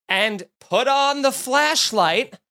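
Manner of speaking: ordinary talk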